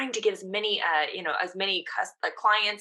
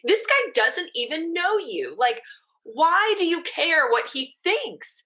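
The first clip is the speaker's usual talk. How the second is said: higher, slower scolding of herself; mock-annoyed inner voice, pitched up; exasperated self-talk; 10 semitones higher, 5 dB louder, slower than usual. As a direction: scold yourself in disbelief, drawn out. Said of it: "I got pissed because I was pissed".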